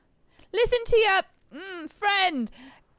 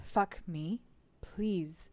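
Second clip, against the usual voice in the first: despairing; 3 semitones lower, 8 dB quieter than usual. Acted. Despairing inner voice.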